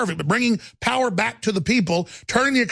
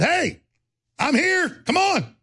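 much higher, pushy call-out, retold; defiant challenge; 11 semitones higher, slower than usual. Host confronting the hecklers outside, a retold defiant call-out. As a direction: dare them to come at you. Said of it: "And I just said".

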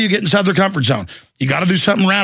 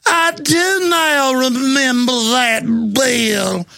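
slow, higher, mocking fake Georgia drawl; mock Georgia accent; 6 semitones higher, much slower than usual. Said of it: "in his fake Georgia accent".

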